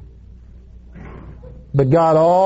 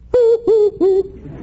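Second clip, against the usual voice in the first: very high little-dog whimpers; imitated dog whimper. Little dog picked up by the jogger, high whimpering.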